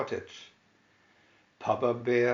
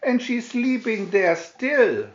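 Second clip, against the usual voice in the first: higher, louder squeaky cub exclaiming; Baby Bear finding Goldilocks in his bed; wee Baby Bear voice; 10 semitones higher, 6 dB louder than usual. A narrator's reading. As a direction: squeak it out like a tiny excited cub. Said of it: "said the Baby Bear in his wee voice".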